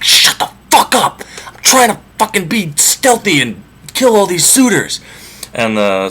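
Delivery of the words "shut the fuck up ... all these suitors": high, strained hissed order; disguised hero frantically silencing the servant girl; urgent hiss. Acted. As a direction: hush her hard but keep it secret. Odysseus hushing the servant.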